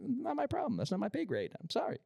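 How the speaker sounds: sing-song not-my-problem brush-off; dismissive high mock voice. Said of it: "and I was like".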